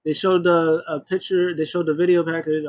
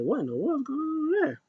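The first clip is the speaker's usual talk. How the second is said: surprised; 12 semitones higher, 5 dB quieter than usual. Retold or imitated. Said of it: "I was like".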